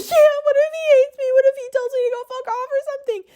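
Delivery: high, fast, teary wail; retold crying panic. Too scared to get his number, panicked teary wail. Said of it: "because I was crying".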